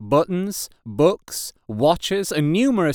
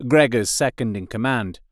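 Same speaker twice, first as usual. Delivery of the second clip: ordinary delivery, nothing marked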